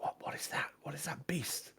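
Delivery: hushed, awed crowd whisper; very soft, voiceless murmur of onlookers. Acted. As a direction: whisper it under your breath.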